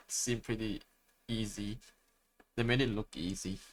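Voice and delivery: ordinary delivery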